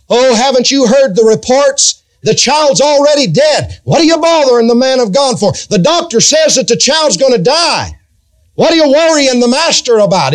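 a preacher mimicking people who scoff at her; mocking naysayers. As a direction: sneer at her like busybodies. Preacher voices dismissive naysayers.

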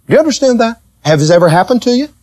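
usual speaking voice

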